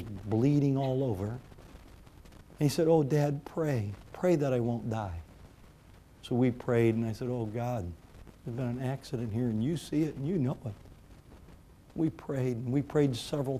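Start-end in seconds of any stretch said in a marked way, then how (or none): 2.9-5.2 put-on soft, frightened child's plea; a hurt boy softly begging his dad; hushed plea. Wounded son pleading. 7.3-10.7 much softer, lower urgent prayer; a father quietly praying over his wounded son; hushed prayer. Retold or imitated.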